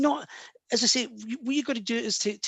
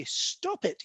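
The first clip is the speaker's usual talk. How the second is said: "Stop it." pitched much higher, sharp and commanding; firm stop; 10 semitones higher, 3 dB quieter than usual. Shown as a demonstration. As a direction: snap "Stop it." at your own doubt. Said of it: "This is where I say".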